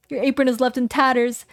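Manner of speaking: ordinary talk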